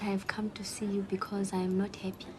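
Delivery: whispered confession. Acted. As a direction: breathe it out with no voice. Client confides her trouble.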